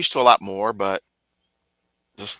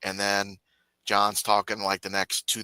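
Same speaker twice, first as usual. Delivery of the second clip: usual speaking voice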